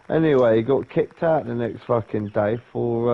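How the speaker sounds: ordinary talk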